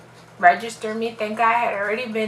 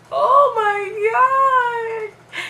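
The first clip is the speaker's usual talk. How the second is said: very high, loud, long-drawn mock sob; hammed-up wailing despair; re-enacted crying wail; 14 semitones higher, 8 dB louder, much slower than usual. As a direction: bawl it out, stretched and piercingly high. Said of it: "And I started crying".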